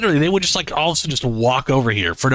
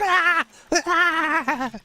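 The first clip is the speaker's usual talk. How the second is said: high mock screams of a scared creature; terrified screams. Mocking his terrified pet, high squealing screams. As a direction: squeal like a frightened little critter. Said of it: "You look so terrified".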